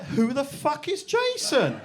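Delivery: high, slow, incredulous outburst; shocked disbelief. Retold or imitated. Mother to daughter, high shocked disbelief. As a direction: hit it like a jolt of alarm. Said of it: "I'm like".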